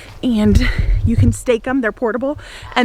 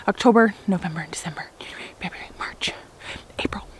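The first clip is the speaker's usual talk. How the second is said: whispered counting; 3 semitones lower, 7 dB quieter than usual. Real, not acted. Counting months under breath.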